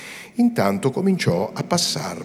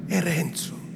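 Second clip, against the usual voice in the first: È Renzo voiced, higher, falling at the end; rueful statement; 10 semitones higher, slower than usual. A narrator's reading. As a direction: say È Renzo aloud with a sad fall. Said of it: "tentennando il capo". (È Renzo detto a voce, cadenza discendente)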